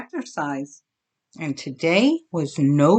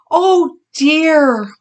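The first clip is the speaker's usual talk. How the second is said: high, loud dismayed oh dear; exclaiming; 8 semitones higher, 5 dB louder than usual. Acted. Mama seeing Bully's tooth, dismayed exclaiming. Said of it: "Mama exclaimed".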